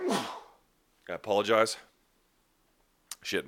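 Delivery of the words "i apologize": holding back a sneeze; about to sneeze. Real, not acted. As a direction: talk through an oncoming sneeze. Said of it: "'cause I gotta sneeze again".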